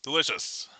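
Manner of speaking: just after a sip of tea; savouring a sip of hot tea; sipping hot tea. Real, not acted. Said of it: "let me take a sip of my tea".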